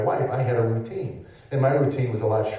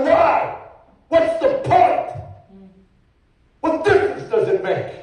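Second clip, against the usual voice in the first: loud, much higher despairing cry; shouting back; 4 dB louder, slower than usual. Retold or imitated. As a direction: yell it back, drawn out. He shouts back at his wife.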